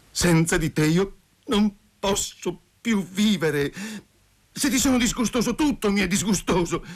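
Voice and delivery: high-pitched anguished outpouring; halting at first, then spill it out high; despairing plea. A narrator's reading. Rejected lover begs Masha to kill him, despairing.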